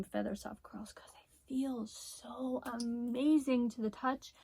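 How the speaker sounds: slow tender whisper. Real, not acted. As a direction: whisper slowly, savoring it. Whispered praise of softness.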